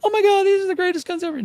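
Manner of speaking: high, gushing mock delight. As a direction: squeal it like a thrilled fan. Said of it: "you're not gonna be like".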